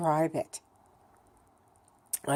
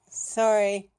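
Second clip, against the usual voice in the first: higher, louder, drawn-out sorry; exasperated, raised-voice sorry; loud pointed apology; 5 semitones higher, 6 dB louder than usual. Real, not acted. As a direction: throw the apology out loudly. Repeats apology louder, annoyed at scolding.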